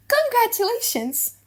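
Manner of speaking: high, bright burst of congratulations; excited congratulation. Acted. Gratiano congratulating the lovers, excited. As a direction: cheer it out for the new couple.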